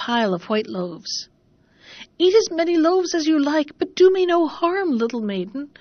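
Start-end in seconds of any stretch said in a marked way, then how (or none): 2.2-5.7 higher, pleading oven voice. A narrator's reading. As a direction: beg the girl not to harm it. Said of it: "cried the oven".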